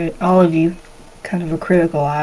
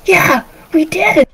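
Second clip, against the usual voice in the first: put-on high, drawn-out proud kid; high child voice, slow and pleased; little boy; 10 semitones higher, slower than usual. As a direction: agree like a delighted young boy. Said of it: "He's eight".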